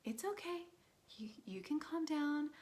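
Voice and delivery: high mock-gentle pleading; appeasing. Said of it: "it'd be like".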